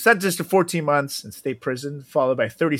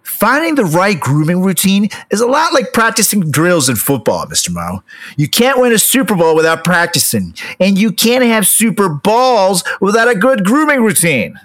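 punchy sponsor read, louder and upbeat; 7 semitones higher, 8 dB louder than usual. Acted. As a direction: sell it like a football pregame hype. Reads the sponsor ad.

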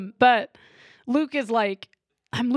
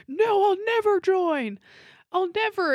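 a mock heroic Luke, raised pitch and slowed; hint of a defiant young Luke; 9 semitones higher than usual. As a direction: lift the voice and drag out a pleading no. Luke's defiant line acted.

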